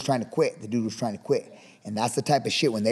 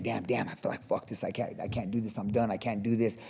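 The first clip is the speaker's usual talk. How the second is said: much softer, low, defeated inner voice; defeated mutter; 3 semitones lower, 8 dB quieter than usual. Retold or imitated. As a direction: mutter it to yourself like you're giving up. Voice in your head quitting.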